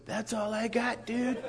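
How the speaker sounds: high, soft dude voice; Mana's dude voice. Retold or imitated. Mana telling the eager guy he's done, spent dude voice. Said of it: "And Mana goes".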